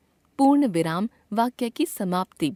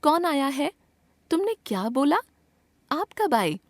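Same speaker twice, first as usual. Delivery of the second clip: higher-pitched questions on "कौन", "क्या", "कब"; question tune; 6 semitones higher than usual. Shown as a demonstration. Teacher gives examples of asking questions, questioning tune. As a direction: ask "कौन आया है" and the rest with lifted pitch. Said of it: "प्रश्न पूछने के लिए प्रश्नवाचक चिन्ह".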